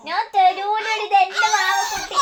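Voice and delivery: high, playful refusal; mock possessive protest. Mother claiming the baby as hers, mock protest. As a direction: squabble in a high pitch, all in play.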